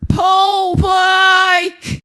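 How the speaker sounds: high, loud commanding yell; police shout. Acted. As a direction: bark the order as you storm in. Police burst through the door.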